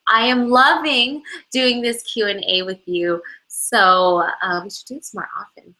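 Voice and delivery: normal talking voice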